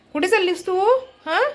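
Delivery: high-pitched call to the little one; high call to pet. Real, not acted. Vlogger calling Billu to stop wandering off.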